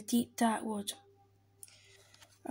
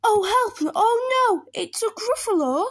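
high, loud cry for help; panicked mouse; 11 semitones higher, 6 dB louder than usual. Acted. Mouse meets the Gruffalo for real, panicked. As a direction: squeal in acted terror.

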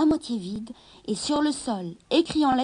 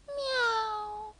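meowing; 10 semitones higher, 5 dB quieter than usual. A narrator's reading. Kitten meows.

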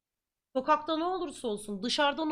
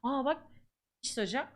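normal talking voice

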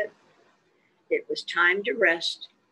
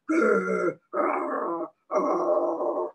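bear growl; 3 semitones lower, much slower than usual. Acted.

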